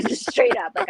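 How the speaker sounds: laughing through the words; giggly, high burst of laughter. Real, not acted. Laughing while explaining her footwork.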